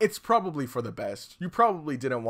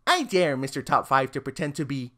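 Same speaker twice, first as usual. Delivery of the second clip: usual speaking voice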